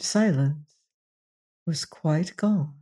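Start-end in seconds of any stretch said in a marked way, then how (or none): none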